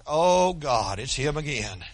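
low, quiet, lightly voiced dismayed clerk; mock dread. Desk lady sees him coming back, mock dread.

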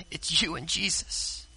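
softly, it's just you and Him; hushed. Acted. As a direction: drop the voice to land it. Quiet emphatic repetition.

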